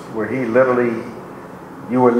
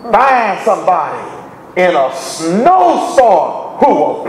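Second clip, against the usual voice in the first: preaching; 6 semitones higher than usual. Acted. Preacher urging the church not to complain, fervent preaching. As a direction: preach it up to the congregation.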